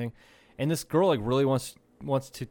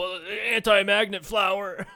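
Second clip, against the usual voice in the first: mock conspiracy excuse; 7 semitones higher, 4 dB louder, slower than usual. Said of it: "'Cause they're like".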